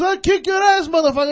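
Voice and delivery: loud, high imitated threat; retold threat. Cabbie threatens driver outside the car, imitated shouting. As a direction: act out the cabbie's angry shout.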